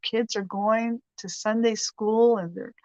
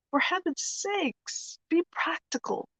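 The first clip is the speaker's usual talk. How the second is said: put-on higher, slow, soft English-accented scold; a prim English lady telling her husband off; 8 semitones higher, 4 dB quieter than usual. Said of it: "She said".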